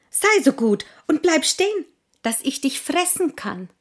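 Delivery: hohe, schnelle Hasenstimme; Hase. Acted. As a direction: hastig wie ein Hase. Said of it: "sagte der Hase". (hastiger Hase)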